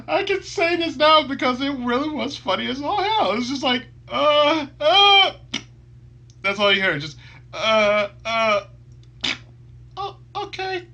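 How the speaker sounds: high, loud words through laughter; barely holding it together; still laughing. Real, not acted. Explaining the funny moment, loud and laughing.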